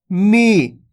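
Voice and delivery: मी with a long, held ee; मी as one sustained syllable; drawn-out long vowel. Shown as a demonstration. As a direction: stretch the vowel in मी. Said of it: "इसी प्रकार से खींच खींच के".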